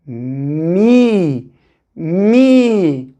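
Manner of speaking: Mi said as one syllable, M sliding into a clear I; blended syllable mi. Shown as a demonstration. Teacher reading the new syllable for pupils. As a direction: join the M and I smoothly. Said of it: "yanına i geldi".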